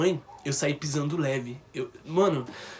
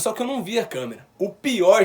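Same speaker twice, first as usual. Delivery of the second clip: ordinary delivery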